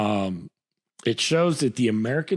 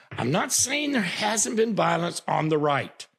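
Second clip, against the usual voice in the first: normal talking voice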